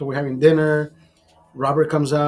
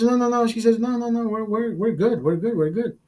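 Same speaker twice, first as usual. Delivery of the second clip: quick, higher-pitched, repetitive refusal; dismissive brush-off; 6 semitones higher than usual. Retold or imitated. Date refusing to go somewhere else, dismissive. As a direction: brush it off in a raised, hurried voice.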